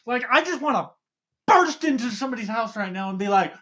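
can't contain the excitement; excited yelling. Real, not acted. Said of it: "why are you yelling".

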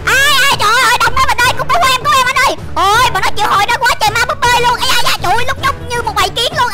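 high, loud, frantic cries for help; panicked shouting. Pretend. Overwhelmed player begging a partner for rescue, panicked. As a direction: yell in alarm as a horde swarms you. Swarm of dolls attacks, pleading help.

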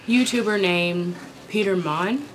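usual speaking voice

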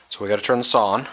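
shouting over table saw. Real, not acted. Talking over running saw.

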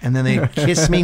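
usual speaking voice

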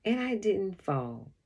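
ordinary delivery

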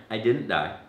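usual speaking voice

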